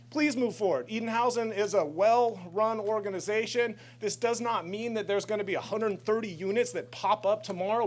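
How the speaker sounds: usual speaking voice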